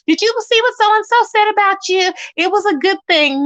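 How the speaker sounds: lightly mocking, high-pitched tattler; mimicked chat gossip. Speaker mimics people reporting gossip in chat, mocking. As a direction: raise the pitch to mimic a busybody.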